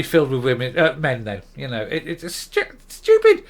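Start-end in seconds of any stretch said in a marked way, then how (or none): 2.3-3.4 exasperated high, loud burst on stupid; real frustration cracks out high; a real frustrated yelp; frustrated outburst. Real, not acted. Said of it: "I, I'm getting frustrated".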